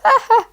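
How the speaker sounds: short burst of laughter. A narrator's reading. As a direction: laugh at the prank. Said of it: "pulled off his mask and laughed".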